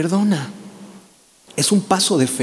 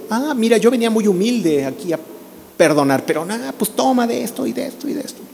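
mock snapping back; 5 semitones higher, 4 dB quieter than usual. Humble forgiver snaps back mockingly.

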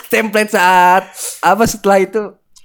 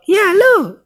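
much higher squeaky mom voice; squeaky woman voice; 12 semitones higher than usual. Pretend. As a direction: play the mother, shrill and high. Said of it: "Lu udah cemprengin doang".

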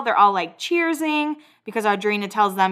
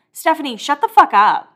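higher, louder mock telling-off; exasperated outburst; 6 semitones higher, 7 dB louder than usual. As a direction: snap at a friend who won't stop talking. Exasperated outburst at absent Stephanie.

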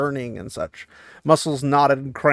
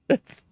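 breathy laugh. Real, not acted. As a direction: laugh under the breath at the silly plot.

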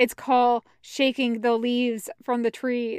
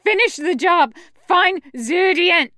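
loud, raised, urgent command; screamed order; 7 semitones higher, 8 dB louder than usual. A narrator's reading. Pinned fighter orders companion to finish the job, screaming. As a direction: yell it as an order from under attack. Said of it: "he screams".

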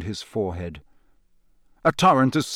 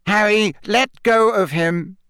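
loud, high urging plea; urging official; 9 semitones higher, 5 dB louder than usual. Acted. Fudge urging Harry to let go of Cedric, loud. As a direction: pull him away with words.

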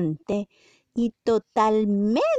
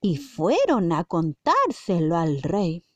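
put-on reading a story to children; story narration; 5 semitones higher than usual. A narrator's reading. Narrating the parable for the children, storybook style. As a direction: tell it like a bedtime Bible story.

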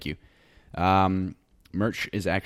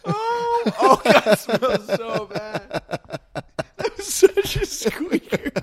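real high, squealing laughing fit; very high, loud, breaking up with laughter; helpless laughing; 8 dB louder, much slower than usual. Real, not acted. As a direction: squeeze the words out while cracking up. Overcome by fart smell, laughing.

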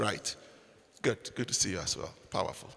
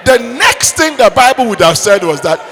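building comic shout; 5 semitones higher, 7 dB louder than usual. Acted. Preacher to congregation, building to a shout. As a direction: swell into the punchline of the slap.

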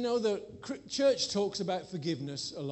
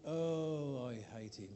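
bitter mutter; 4 semitones lower, 6 dB quieter, slower than usual. Retold or imitated. Retold bitter thought at conference.

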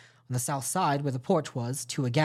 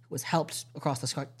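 plain reading voice

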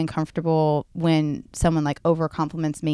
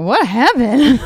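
put-on higher, louder startled kid; startled child; 7 semitones higher, 7 dB louder, much slower than usual. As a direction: voice the shaken daughter. Daughter voiced after crash.

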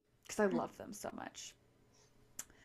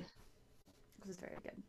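whispered slow, dreamy "very good"; savoring delicious food; 17 dB quieter, slower than usual. Real, not acted. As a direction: breathe it out as if you can still taste it. Dreamily recalling the salmon breakfast.

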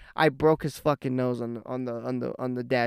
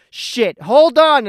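loud, high warning yell; 10 semitones higher, 13 dB louder, slower than usual. Retold or imitated. Driver warning his brother before the ditch, yelling. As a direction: yell it out like bracing for a crash. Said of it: "I just yell".

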